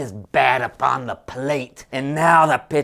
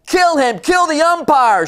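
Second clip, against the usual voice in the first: high, loud yell from the stands; angry fan shout; 5 dB louder than usual. Acted. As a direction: scream it like a furious fan. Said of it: "shouted someone in the stand".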